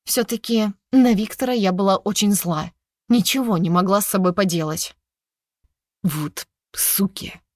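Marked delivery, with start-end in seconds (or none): 6.0-7.4 slow, whispered, furious curse; angry hiss through teeth. Acted. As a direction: spit it out in a low whisper. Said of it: "зло процедил Санта-старший".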